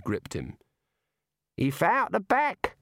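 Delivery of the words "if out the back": high, slow Aussie barman; Dongo's Aussie voice. Acted. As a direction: point him out back. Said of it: "said Dongo".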